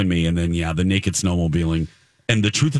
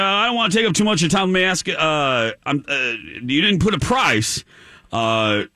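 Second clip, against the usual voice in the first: put-on manly caller, pitched up; 7 semitones higher than usual. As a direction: play an eager prank caller in a raised manly voice. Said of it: "that was my manly voice".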